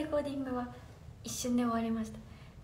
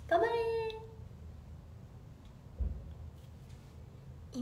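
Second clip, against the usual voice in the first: gentle cheer; 7 semitones higher, 6 dB quieter, much slower than usual. Real, not acted. Cheering on viewers.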